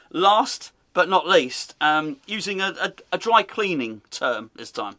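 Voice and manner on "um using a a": ordinary talk, nothing marked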